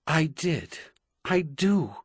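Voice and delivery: plain reading voice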